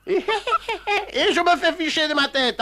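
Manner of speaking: indignant words breaking into laughter; laughing while complaining. Real, not acted. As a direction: complain but can't hold the laugh.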